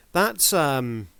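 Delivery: usual speaking voice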